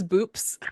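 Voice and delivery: BOOPS with a P for the final B; boyfriend's mispronunciation. Retold or imitated. Woman quoting her Russian boyfriend's slip, imitated mispronunciation. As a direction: say "boops" with a crisp P, imitating him. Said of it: "he always says".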